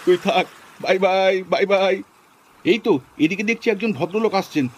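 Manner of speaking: normal talking voice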